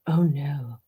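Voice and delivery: low, whispered reaction; hushed dismay. Real, not acted.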